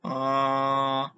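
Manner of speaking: AH drawn out, rising moderately; long AH gliding gently upward; second tone. Shown as a demonstration. Teacher showing beginners the second tone, AH rising. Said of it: "The second tone is rises moderately".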